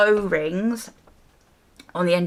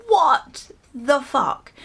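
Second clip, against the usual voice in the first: slow, higher disbelieving what the fuck; shocked outrage; 7 semitones higher, 4 dB louder, much slower than usual. Real, not acted. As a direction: drag it out in stunned disbelief. Shocked at the garage bill.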